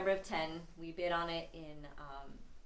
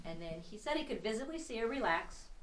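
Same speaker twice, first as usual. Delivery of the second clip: usual speaking voice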